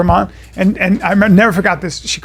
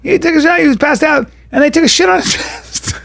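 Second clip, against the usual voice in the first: lightly voiced college girl, higher, laughing; imitating sister; 7 semitones higher than usual. Retelling sister's call, higher disbelieving girl voice. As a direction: mimic her shocked retelling a bit higher. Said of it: "He goes".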